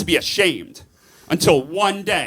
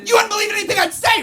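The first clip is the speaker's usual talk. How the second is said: higher camp voice; 8 semitones higher than usual. Shown as a demonstration. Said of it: "if I talk like this".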